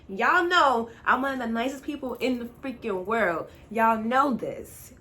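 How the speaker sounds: loud, emphatic self-defence; retold protest, punched out loudly; indignant protest. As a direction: insist on it, slowing right down for the last phrase. Indignant protest of her own niceness.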